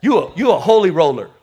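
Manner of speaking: low, teasing unsaved relative; mocking relatives. Unsaved family teasing the believer, low and mocking. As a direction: needle them with a chuckle. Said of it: "they look at you and say".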